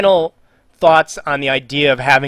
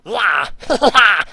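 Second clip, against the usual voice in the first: high, wild cartoon creature yells; Rabbid yelling; 7 semitones higher than usual. Shown as a demonstration. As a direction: burst into manic nonsense Rabbid cries. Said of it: "I can do a rabbit impression".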